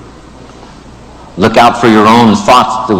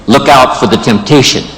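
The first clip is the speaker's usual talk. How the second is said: ordinary talk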